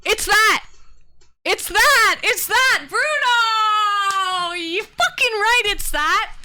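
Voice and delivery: high, loud shrieks of real triumph; screaming with joy at finally finding it; ecstatic shouting. Real, not acted. Streamer shouting to chat on finding the song, ecstatic yell.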